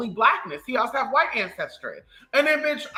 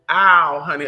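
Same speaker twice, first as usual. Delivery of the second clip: ordinary delivery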